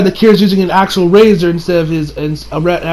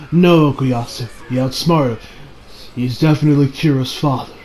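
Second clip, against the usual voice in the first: lower, softer deadpan reply; calm older brother; 6 semitones lower, 6 dB quieter than usual. Acted. Older brother corrects Okuyasu, lower and deadpan. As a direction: correct him dryly, voice dropped.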